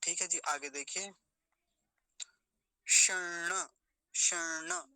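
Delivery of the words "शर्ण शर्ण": शर्ण with the retroflex n held, then "na"; शर्ण as "shan-na", tongue curled back; double ण. Shown as a demonstration. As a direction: linger on the ण in शर्ण. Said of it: "एक णकार हलंत और एक णकार पूरा है".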